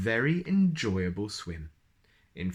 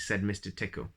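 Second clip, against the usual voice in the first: ordinary delivery, nothing marked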